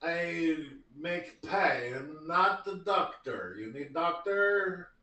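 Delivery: slow, broken Eastern European baker; halfling baker in an Eastern European accent. Acted. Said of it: "less of a Eastern European".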